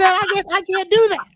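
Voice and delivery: a hint of a high-pitched comic rant; Bill Burr bit. Retelling a favorite comedian's joke, mock exasperation. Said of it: "one of my favorite Bill Burr jokes".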